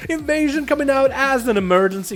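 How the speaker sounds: high, loud, rapid match call; play-by-play commentary. Real, not acted. Arena caster calling a duel for viewers, excited play-by-play. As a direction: call the fight urgently as it unfolds.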